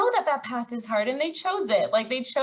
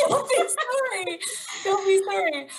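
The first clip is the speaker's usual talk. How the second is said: high, laughing, drawn-out consolation; high-pitched insistent comfort; emotional reassurance; 10 semitones higher than usual. Real, not acted. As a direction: squeal it tenderly through a laugh. Consoling an apologising friend.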